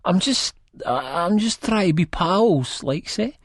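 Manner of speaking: high, hesitant excuse; stammering plea. A narrator's reading. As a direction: plead haltingly to be liked. Spud pleads, stammering, to be friends.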